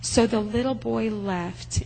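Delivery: usual speaking voice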